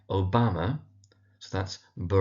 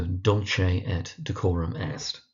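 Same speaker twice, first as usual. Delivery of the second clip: slow Dulce et Decorum Est, leaning on DUL and COR; stress on dul, cor; slower than usual. Shown as a demonstration. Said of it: "Emphasis on the dul and the cor".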